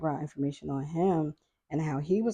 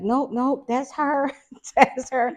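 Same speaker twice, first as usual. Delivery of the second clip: higher, louder insistence breaking into a laugh; mocking the kids; 9 semitones higher, 6 dB louder, slower than usual. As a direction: imitate them pointing her out, laughing. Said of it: "But they was like".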